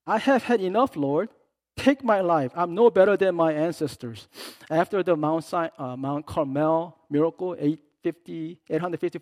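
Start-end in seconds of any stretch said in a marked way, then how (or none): 0.0-4.3 higher, loud exhausted plea; Elijah's despair. Retold or imitated. Elijah begging God to take his life, loud and weary. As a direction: fling the words up at God, worn out.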